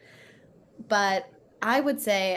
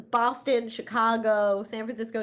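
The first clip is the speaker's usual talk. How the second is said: usual speaking voice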